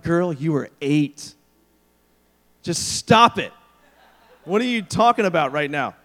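mock-exasperated dad, punchy and emphatic. Dad reacts to eight-year-old daughter's husband talk, mock exasperated. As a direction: drag out the first words, then come down hard.

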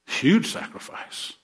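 slow, whispered mock awe; sarcastic whisper. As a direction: breathe it out slowly, mocking. Sarcastic aside about small sacrifices.

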